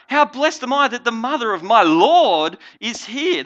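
high, loud retold exclamation. Cousin greeting the mother of her Lord, loud exclamation. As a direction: act out her amazed cry at full voice. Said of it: "In a loud voice, she exclaimed".

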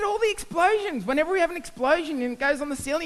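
a hint of a chirpy colleague; lightly voiced colleague. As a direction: rattle off the rule. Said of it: "He said".

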